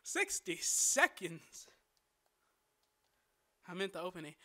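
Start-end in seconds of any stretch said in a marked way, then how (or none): 0.0-1.7 drawn-out, excited cry; big, slow-motion exclamation. Real, not acted. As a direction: stretch it out with mounting hype. Stream countdown nearly over.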